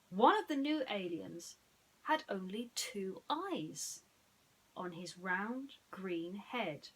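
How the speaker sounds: ordinary delivery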